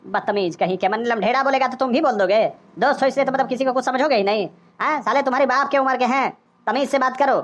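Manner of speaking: low-pitched, put-on older man's rebuke; scolding uncle. Uncle telling off a rude youngster, indignant.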